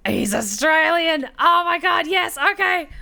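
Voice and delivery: high, loud fangirl excitement; thrilled outburst. Real, not acted. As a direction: burst out, overjoyed at the discovery. Thrilled that character is Australian.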